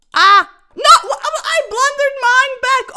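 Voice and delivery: loud laughing dismay, high. Real, not acted. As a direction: laugh through the blunder. Laughing at own blunder.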